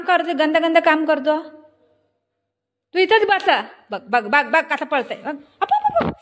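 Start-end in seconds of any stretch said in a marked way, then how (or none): 5.6-6.1 much higher laughing cheer; playful cheer. Real, not acted. (खेळकर आनंदी हाक)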